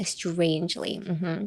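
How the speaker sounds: "strangely" said softly through a fake smile; soft, insincere smile in the voice; awkward fake smile. Shown as a demonstration. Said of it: "気まずスマイル". (気まずスマイルで小声で)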